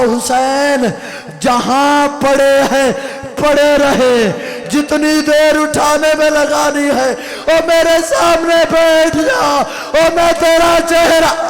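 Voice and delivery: rising to a high, loud wailing cry; a sister begging her brother to stay, wailing; wailing plea. Acted. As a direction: plead as a grieving sister, voice cracking upward. Zainab's plea voiced in lament.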